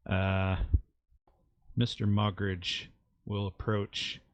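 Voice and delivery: normal talking voice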